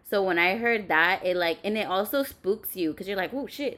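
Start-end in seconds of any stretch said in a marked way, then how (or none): 3.3-3.8 startled. Acted. Startled by the loud muffler.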